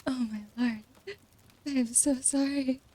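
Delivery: high, breathless laughing through words; flustered and giggling, face so red; embarrassed laughing squeal. Real, not acted. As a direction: gasp it out between giggles. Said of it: "my face is so red".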